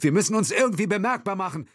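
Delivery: high, fast, urgent; urgent plan. A narrator's reading. Will urges they must get noticed, high and fast. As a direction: hurry the words.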